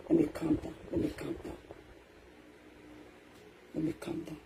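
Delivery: whispered let me calm down. Acted. Calming himself before the crowd, whispered. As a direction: breathe it out under your breath. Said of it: "I cool my temper".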